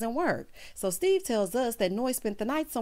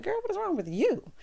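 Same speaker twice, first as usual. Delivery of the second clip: mock incredulous; 10 semitones higher than usual. Her own reaction, high.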